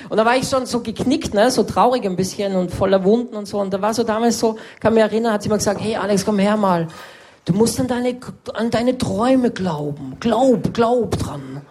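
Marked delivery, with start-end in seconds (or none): 5.8-11.6 mystical teacher. Retold or imitated. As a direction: coo it like a spacey eco teacher. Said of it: "so mystisch gesprochen". (esoterische Lehrerin spricht verträumt und höher mit Alex)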